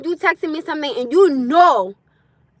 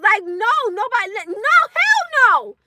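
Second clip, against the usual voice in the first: emphatic refusal; 6 semitones higher than usual. Real, not acted. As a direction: stretch out the refusal.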